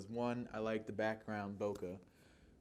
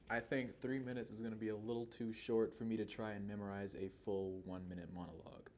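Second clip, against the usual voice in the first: normal talking voice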